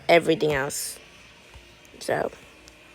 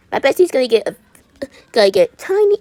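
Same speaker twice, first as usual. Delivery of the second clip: louder, higher, gushing over bestie; excited; 6 semitones higher, 7 dB louder, faster than usual. Real, not acted. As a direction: hype up the bestie perk. Annonce excitée pour la meilleure amie.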